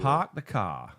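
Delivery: Bostonian "park the car" without r's; Boston accent. Shown as a demonstration. Repeating the chat's phrase for a co-host, Boston accent. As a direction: say it like a Bostonian, pahk the cah. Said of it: "then Bostonian".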